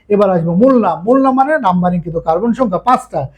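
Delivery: ordinary talk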